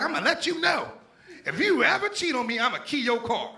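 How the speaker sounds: put-on high-pitched threatening girlfriend; shrill-pitched mock threat; mock angry girlfriend. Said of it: "She went off on me".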